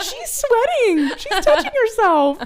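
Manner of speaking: very high, drawn-out mock gasp; hammed-up scandalized accusation; teasing accusation. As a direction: squeal the evidence with laughter near. Acting out teasing accusation of flirting.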